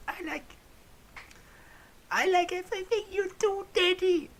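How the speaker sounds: very high, loud hammed-up moan; half moaned.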